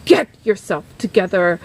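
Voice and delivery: low, stern imitation of the producer; mock gruff command. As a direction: drop low and order firmly.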